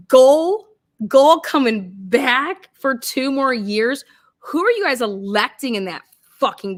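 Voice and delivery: loud, drawn-out outraged yell; ranting shout. Real, not acted. Ranting vlogger shouting at Indiana voters. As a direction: yell the name in disbelief.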